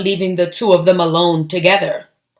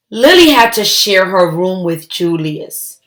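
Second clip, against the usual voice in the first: plain reading voice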